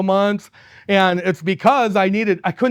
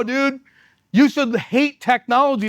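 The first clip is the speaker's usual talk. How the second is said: ordinary talk, nothing marked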